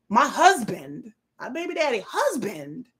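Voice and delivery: "husband" drawn out slowly and emphatically; stress on husband. Retold or imitated. Correcting a troll's baby daddy jab, stressed "husband". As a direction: lean hard on "husband" as a correction.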